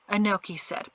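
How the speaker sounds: plain reading voice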